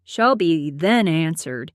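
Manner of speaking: usual speaking voice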